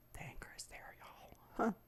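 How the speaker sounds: very soft, near-whispered admission; hushed confession. Real, not acted. Podcaster admitting anger to listeners, hushed. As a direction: let it out under your breath.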